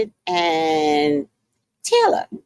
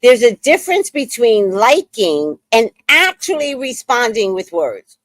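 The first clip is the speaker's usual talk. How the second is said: mocking his words; 5 semitones higher, 3 dB louder than usual. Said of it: "he says to her".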